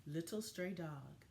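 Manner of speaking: gentle, expressive picture-book narration; reading to children. A narrator's reading. Teacher reading a story to her class, storybook voice. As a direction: tell it like story time for young kids.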